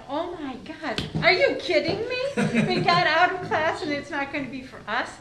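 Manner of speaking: high, loud, laughing disbelief; retelling a laughing, shocked teenage reaction; incredulous outcry. Retold or imitated. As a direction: gasp it out, half laughing, can't believe it.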